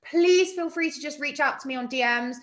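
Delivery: usual speaking voice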